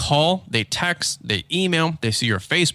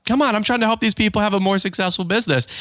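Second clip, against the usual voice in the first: higher-pitched retold complaint; mock pleading protest; 7 semitones higher than usual. Husband defending his podcast to teasers, mock pleading. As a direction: plead your case in playful exasperation.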